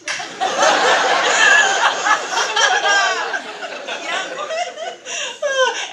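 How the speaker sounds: long, high, loud laugh. Real, not acted. Host cracking up at the impression, long loud laugh.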